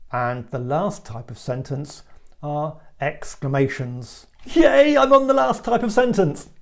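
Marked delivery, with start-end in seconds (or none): 4.4-6.5 high, loud cheer; excited exclamation. Shown as a demonstration. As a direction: exclaim it with big demonstrative excitement. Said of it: "The sentence should sound exciting or amusing".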